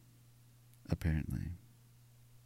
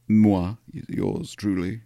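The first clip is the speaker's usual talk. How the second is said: grand playful; 4 semitones higher, 9 dB louder, much slower than usual. Pretend. Playful self-reference in French.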